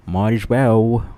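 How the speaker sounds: slow, high fake British "Might as well"; bad British accent. Joking to the stream in a bullshit British accent. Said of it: "in my bullshit British accent".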